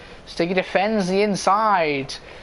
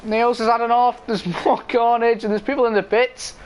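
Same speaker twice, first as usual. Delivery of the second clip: motorsport commentator; 5 semitones higher than usual. Real, not acted. Live race play-by-play.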